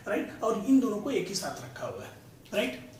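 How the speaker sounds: usual speaking voice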